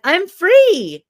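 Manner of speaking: loud, high-pitched exuberant cry; hammed-up burst of joy; gleeful outburst. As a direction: shout it like a kid let loose. Acting out her child self's thought.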